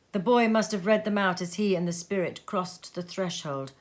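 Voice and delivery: usual speaking voice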